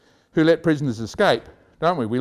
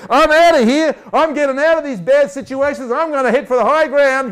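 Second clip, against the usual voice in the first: eager escape; 8 semitones higher, 6 dB louder than usual. Retold or imitated. Quoting someone fleeing.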